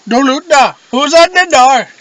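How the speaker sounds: high, loud mocking call. Friend mimics drunk prom banter, loud mock call. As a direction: call out in a teasing put-on voice. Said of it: "I don't wanna say her name".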